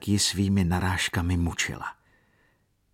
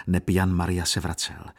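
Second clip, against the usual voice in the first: usual speaking voice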